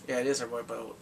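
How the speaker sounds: ordinary talk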